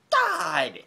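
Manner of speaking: high, loud, fed-up cry; exasperated cry. Real, not acted. Fan disgusted by the Jets, crying out. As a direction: blurt it out in disgust.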